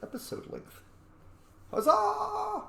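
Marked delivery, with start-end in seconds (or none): 1.7-2.6 high, triumphant cheer of victory. Real, not acted. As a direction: shout it like you just won the fight.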